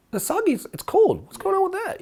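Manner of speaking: high, playful, curious query; light curious question. Retold or imitated. Friend asking the waiter lightly about cold sake. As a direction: ask lightly, nothing accusing. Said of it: "I'm saying".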